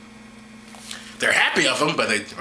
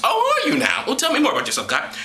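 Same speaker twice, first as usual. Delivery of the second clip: sarcastic; 9 semitones higher than usual. Acted. Sarcastic reply to scripture.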